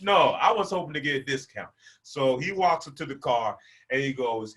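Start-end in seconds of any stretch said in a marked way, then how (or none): none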